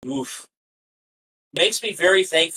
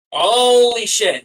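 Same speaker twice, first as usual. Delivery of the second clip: slow, higher, louder holy shit of alarm; retold shocked outburst; 7 semitones higher, 6 dB louder, much slower than usual. Fan recalls seeing the crash, stunned, slow alarmed outburst. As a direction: drag it out as the horror hits you.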